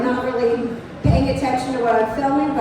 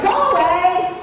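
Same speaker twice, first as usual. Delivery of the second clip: a louder, higher go away at the bear; mock scream; 5 semitones higher, 5 dB louder, slower than usual. Camper yelling at a cute bear to leave, raised mock scream. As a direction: shoo the bear off with a yell. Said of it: "I'm screaming at the bear to go away".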